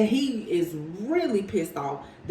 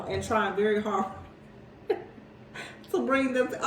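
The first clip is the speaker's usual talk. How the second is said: ordinary talk, nothing marked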